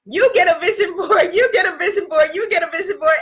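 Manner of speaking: high, loud hammed-up Oprah giveaway. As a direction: imitate Oprah handing out gifts. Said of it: "says in her Oprah voice".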